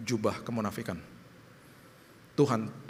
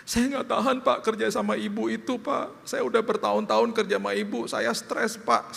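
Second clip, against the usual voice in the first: higher, pleading voice of a tearful driver; a hint of sobbing complaint, voiced higher; imitating a crying driver; 6 semitones higher than usual. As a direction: plead like a driver close to tears, but only lightly acted. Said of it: "Tiba-tiba dia nangis".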